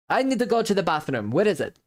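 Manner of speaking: a sneaky rogue's offhand excuse; fake innocent excuse.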